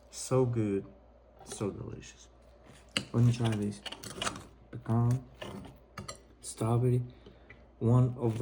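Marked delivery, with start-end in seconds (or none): 1.4-2.3 whispering. Real, not acted. Tasting the pancakes.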